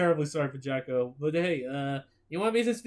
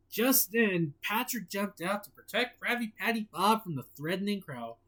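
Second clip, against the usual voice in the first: ordinary delivery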